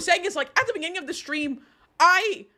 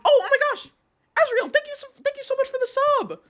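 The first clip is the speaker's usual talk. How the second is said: much higher, gushing thank-you to a subscriber; excited thanks; 12 semitones higher, faster than usual. Real, not acted. Streamer thanks a viewer for subscribing, high and excited. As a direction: squeal your thanks in delight.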